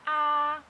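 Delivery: "ah" held high and level; high, even, drawn-out "ah"; first tone. Shown as a demonstration. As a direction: sustain "ah" on one high, steady pitch.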